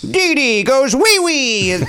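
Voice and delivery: high, stretched-out home-run catchphrase; hammed-up announcer crowing slowly; John Sterling. Host mocks the Yankees announcer's call, high and gleeful. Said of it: "what, uh, John Sterling would say".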